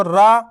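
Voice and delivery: रा drawn out long and loud; stretched ra. Shown as a demonstration. Teacher drawing out रा for the class. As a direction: pull the रा vowel out long. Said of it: "रा को खींचो".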